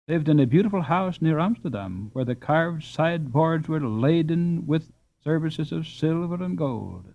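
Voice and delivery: plain reading voice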